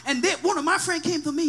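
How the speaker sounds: normal talking voice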